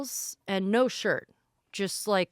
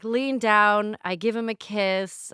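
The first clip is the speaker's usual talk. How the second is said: ordinary delivery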